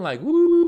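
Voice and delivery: high loud whooping woo; mock war whoop. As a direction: whoop it up like a cartoon.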